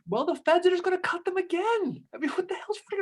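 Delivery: high-pitched mock exasperation; exasperated retort. Host acting a general countering his commander, exasperated. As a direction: argue back, voice shooting up.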